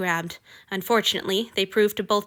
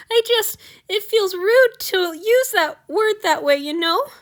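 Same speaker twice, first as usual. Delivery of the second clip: high, slow teary babble; tearful babbling; 11 semitones higher, 4 dB louder, slower than usual. A narrator's reading. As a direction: plead through rising tears. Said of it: "he was babbling now".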